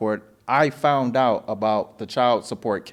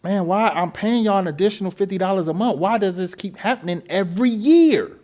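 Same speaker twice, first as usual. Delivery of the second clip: exasperated complaint; 7 semitones higher than usual. Retold or imitated. Retold complaint to phone agent.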